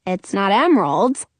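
ordinary delivery, nothing marked

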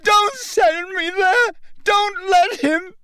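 very high, sobbing terrified screams; a boy dragged off begging his mother; screaming and crying. A narrator's reading. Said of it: "The boy began to scream".

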